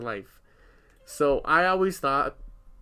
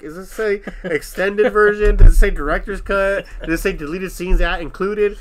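higher and louder, puzzled; bewildered questioning; 5 semitones higher, 10 dB louder than usual. Retold or imitated. Retelling checking the DVD box, puzzled and louder. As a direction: reenact the confused search.

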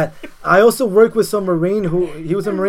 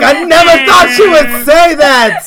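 laughing quote; 10 semitones higher, 12 dB louder, slower than usual. Retold or imitated. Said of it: "He's like".

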